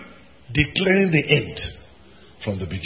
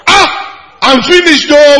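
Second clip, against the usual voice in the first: high, loud wail of being finished; mock despair; 13 semitones higher, 12 dB louder than usual. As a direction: ham up a panicked cry. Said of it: "What would have happened to God?".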